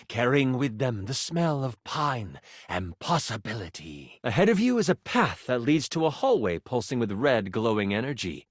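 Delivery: low, hushed-toned atmospheric narration; game master scene-setting. A narrator's reading. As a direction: set the scene in a low storyteller's voice. Game master sets the night scene.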